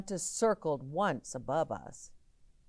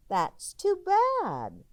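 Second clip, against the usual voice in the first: high, slow mother; a lightly sing-song mom; mother's voice; 8 dB louder, slower than usual. Acted. Mother dismissing the silent bell. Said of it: "my mother said".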